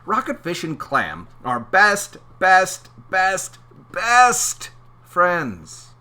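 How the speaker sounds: high, loud, stretched best best best; hammed-up emphasis on best; drawn-out bests. Reading picture book aloud.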